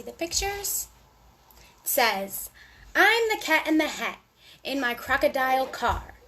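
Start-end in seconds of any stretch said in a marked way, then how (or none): none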